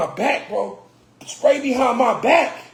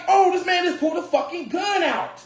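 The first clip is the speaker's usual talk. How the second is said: shocked; 7 semitones higher than usual. Retold or imitated. Friends shocked at gun.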